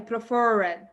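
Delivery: "Preferred" com o R caipira bem puxado; "Preferred" puxando bem o R. Shown as a demonstration. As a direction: puxe forte o R de "Preferred". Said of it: "Pode puxar bem o R". ("Preferred" com R caipira puxado)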